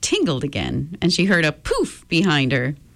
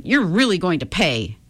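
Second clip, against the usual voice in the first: plain reading voice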